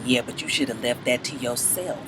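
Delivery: very low, hushed imitation; mediator's quiet aside. Man playing mediator between two women, very low murmur. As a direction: drop right down and murmur it. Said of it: "trying to play the, the mediator".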